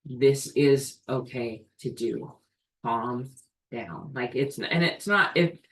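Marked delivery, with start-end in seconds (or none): 0.1-4.1 lower, softer, reassuring the body; calm your body like a frightened child; soothing self-talk. Shown as a demonstration. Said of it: "I say".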